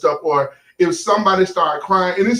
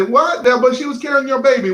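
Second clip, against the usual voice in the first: incredulous objection; 7 semitones higher than usual. Pretend. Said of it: "And you might say".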